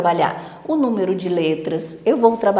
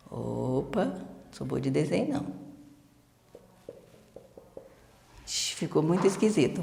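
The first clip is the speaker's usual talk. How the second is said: muttered aside; 7 semitones lower, 13 dB quieter than usual. Real, not acted. Muttering while drawing.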